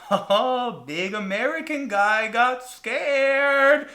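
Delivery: high, slow teasing; mocking. Small tour guide teasing the big American, mocking. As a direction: draw it out to taunt. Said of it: "had the audacity to come up".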